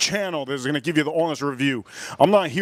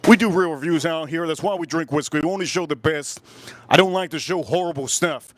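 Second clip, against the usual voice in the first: ordinary talk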